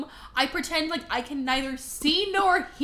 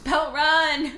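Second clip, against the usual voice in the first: louder, higher mock warning cry; urgent warning; 4 semitones higher, 6 dB louder, slower than usual. Urging Belle out of the castle, mock alarmed shout.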